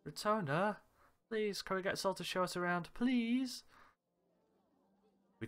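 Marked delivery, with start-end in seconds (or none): 0.1-3.6 eager pleading. Acted. Begging to hire Sol.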